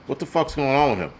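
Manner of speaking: ordinary talk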